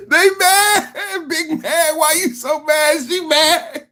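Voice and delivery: high, laughing taunt; mocking laughter. Real, not acted. Host mocking the angry streamer, laughing taunt. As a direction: tease her while laughing.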